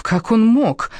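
high-pitched, indignant outburst; anger. Acted. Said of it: "Меня переполнял гнев". (гневный высокий возглас)

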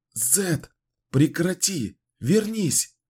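begging him to come back; desperate pleading. Acted. Shaking the unresponsive wizard. (отчаянная мольба)

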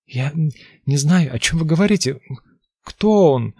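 high, loud panicked stammer; terrified pleading. Acted. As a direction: beg in high-pitched terror.

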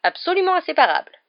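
very high, loud piping voice; tiny excitable professor, voice shooting up; squeaky Flitwick. A narrator's reading. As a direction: squeak it out emphatically. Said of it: "intervint le professeur Flitwick".

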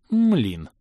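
досадный возглас с оттенком восхищения; чуть повыше, с лёгким изумлением; некоторое восхищение. A narrator's reading. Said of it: "С некоторым даже восхищением выразился я". (невольно восхититься врагом)

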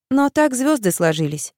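plain reading voice

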